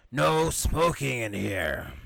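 drawn-out mock usherette reprimand; slow, stern telling-off, hammed up; scolding usherette. Usherette telling a smoker off, mock scolding.

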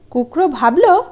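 plain reading voice

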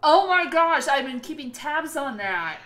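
loud, high burst of excitement; real excitement. Real, not acted. Co-host reacting to Jordan Peele's upcoming movie, excitedly. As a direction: gush loudly over the news. Thrilled at mention of new movie.

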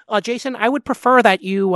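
high nasal-free nerdy whine; lightly voiced prim nerd. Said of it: "like, nerd voice".

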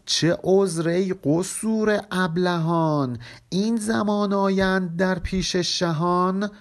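slow, measured verse reading; poem recitation. Acted. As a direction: chant each line of the couplet slowly. (خوانش آهسته و موزون شعر)

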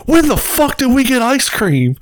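high-pitched, indignant mock yell; imitated yell. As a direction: yell it like a heckler from the back. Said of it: "this motherfucker just yells from the background".

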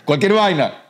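high, scornful throwaway voice; put-on voice, pitched high, brushing it off; mock dismissive. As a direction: toss off "cualquier vaina" high and mocking. Quotes the dismissive phrase.

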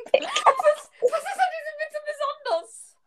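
very high squealing laugh-talk; helpless laughter. Real, not acted. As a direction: crack up and squeeze the question through real laughter. Cracking up at the punchline.

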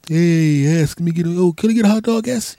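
high, put-on voice of the neighbor; mock neighbor voice. The neighbor asking for a hot dog, high mock voice. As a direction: squeak it out like the pushy neighbor. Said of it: "neighbor asking for hot dog".

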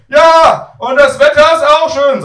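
put-on very high, booming old-lady holler; shouting granny. Grandmother misunderstanding grandson on phone, loud high shout. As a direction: shout it down the phone, mishearing everything. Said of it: "Sie schreit so laut".

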